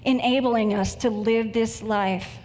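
earnest, measured prayer voice. Acted. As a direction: congregation prayer before the sermon, reverent and unhurried. Opens sermon with prayer.

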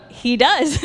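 high, loud happy confirmation; retold burst of eager reassurance; emphatic assurance. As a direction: exclaim it as glad news, close to laughing. Retold delighted confirmation, then laughs.

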